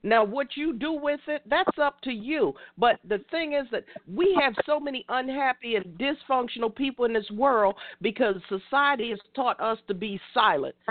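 normal talking voice

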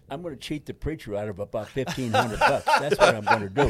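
laughing through the joke; real laughter rising to a high cackle. Real, not acted. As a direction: chuckle out the punchline. Joking about cremation cost.

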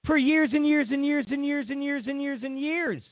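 high, rolling sing-song repetition; exaggerated repetition. Real, not acted. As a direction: keep each repeat pitched high and evenly rhythmic.

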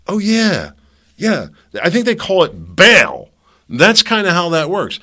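suddenly high and loud, yeah I get it; loud, high-pitched realization; excited realization. Real, not acted. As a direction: burst out with the answer, loud and high.

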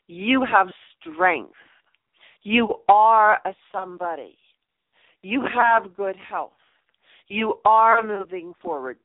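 measured mirror affirmations spoken slowly; firm affirmations. Shown as a demonstration. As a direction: say each line like you believe it. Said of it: "and you tell them".

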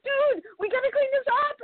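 very high, urgent mock plea; retold panic. Retold urgent plea to clean blood.

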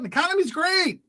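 mock upbeat claim, pitched very high; high-pitched mocking cheer; Hillary Clinton. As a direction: parody a politician's rosy boast, way up high. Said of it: "according to Hillary Clinton".

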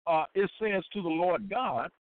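normal talking voice

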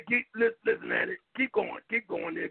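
ordinary talk

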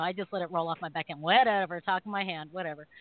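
usual speaking voice

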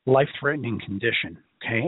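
ordinary talk

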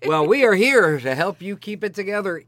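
normal talking voice

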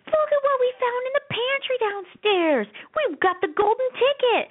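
put-on very high, slow singsong child voice; gleeful kids showing off a treasure; excited kids. Kids announce a pantry find to mom, excited child voices. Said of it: "And the kids go".

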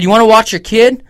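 high, loud scolding shout; telling off the careless mom; scolding call. Retold or imitated. Shouting at the mom down the aisle, scolding. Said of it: "I said".

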